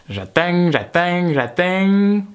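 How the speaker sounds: put-on accent with twanging nasalised endings. Comedian waits on the phone, silly put-on accent. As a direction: say "attends" as "attaing" in a put-on accent. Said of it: "pourquoi je prends cet accent".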